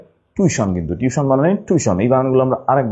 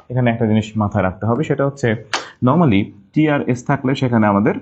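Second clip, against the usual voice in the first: normal talking voice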